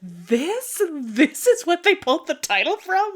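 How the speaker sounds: can't believe what you just read; incredulous reaction. Retold or imitated. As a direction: recount it as astonished, amused disbelief. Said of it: "it was not what I was expecting".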